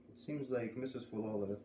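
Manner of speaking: usual speaking voice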